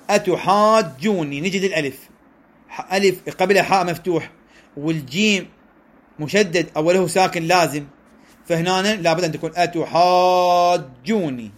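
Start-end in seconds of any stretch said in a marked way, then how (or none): 0.1-1.4 أتحاجوني slowly, with the long vowels drawn out; stretched madd. Shown as a demonstration. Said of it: "فلهذا قال". 9.5-11.5 أتحاجوني slowly, with the long vowels drawn out; stretched madd. Shown as a demonstration. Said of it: "هذا مد".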